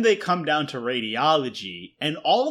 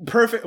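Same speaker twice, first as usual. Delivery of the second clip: "Perfect" hit higher, scoffing; sarcastic emphasis; 7 semitones higher, 3 dB louder than usual. Real, not acted. Mocking the layman's idea of a perfect photo, scoffing. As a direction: spit the word back in scorn. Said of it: "so far from perfect".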